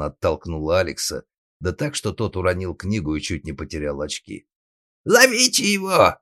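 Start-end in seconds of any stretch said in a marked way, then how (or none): 5.0-6.2 high, loud cry for pursuit; yelling. Acted. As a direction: yell to stop the runaway. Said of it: "заорал папаша".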